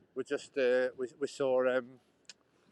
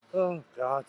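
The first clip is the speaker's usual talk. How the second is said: ordinary talk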